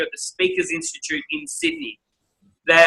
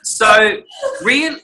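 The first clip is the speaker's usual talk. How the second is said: laughing; 7 semitones higher, 4 dB louder, much slower than usual. Real, not acted. Laughing after the joke.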